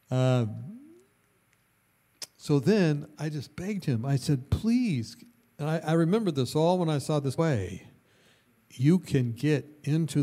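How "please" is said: mock pleading. Retold begging.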